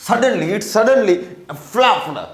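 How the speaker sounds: ordinary talk, nothing marked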